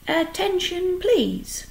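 high-pitched announcement to a crowd; calling for attention. A narrator's reading. Pig addressing all the barn animals, calling for attention.

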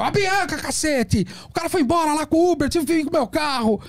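high, exasperated outburst; Leandro Real, irritated. Retold or imitated. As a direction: vent like a man fed up after the Uber left. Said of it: "o primeiro foi o Leandro Real".

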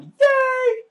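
high happy squeal; excited squeal. Real, not acted. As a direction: squeal with delight. Grabbing the rainbow.

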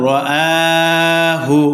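long held vowel, chanted evenly; measured melodic tajweed chant; slow Quran recitation. Shown as a demonstration. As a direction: recite slowly, stretching the middle vowel. Recites the word being taught.

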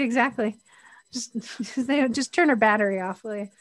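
normal talking voice

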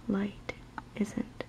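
ordinary talk

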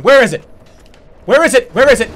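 frantic searching. Real, not acted. Frantic search mid-fight.

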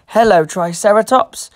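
ordinary delivery, nothing marked

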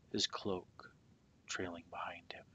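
ordinary delivery

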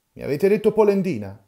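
loud, high indignant challenge; furious old man demanding an answer; angry. Acted. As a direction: throw the accusation hotly. Old men quarrelling over insult.

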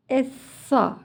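ESSA with a heavy s and dark open "a"; thick s. Shown as a demonstration. Teacher taking a turn for children to copy, thick s. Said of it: "kalın bir sa".

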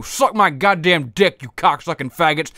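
ordinary talk, nothing marked

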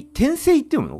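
high incredulous question; surprised. Real, not acted. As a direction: ask in disbelief. Surprised at the reading.

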